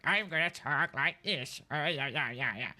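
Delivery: mock an old granny croak; old lady hag voice. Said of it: "used the old lady hag voice".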